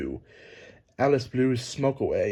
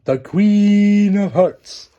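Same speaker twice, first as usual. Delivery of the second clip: high, loud, very slow proclamation; herald announcement; 8 semitones higher, 9 dB louder, much slower than usual. Acted. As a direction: announce the royal title to the whole court.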